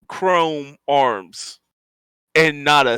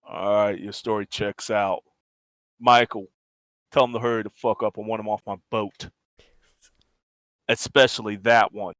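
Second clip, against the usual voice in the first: lightly voiced boss, low and curt; begrudging cyborg boss; 5 semitones lower, faster than usual. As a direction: lower the voice, dismiss them grudgingly. Game master voices the cloaked cyborg.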